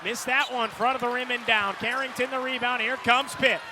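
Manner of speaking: ordinary talk, nothing marked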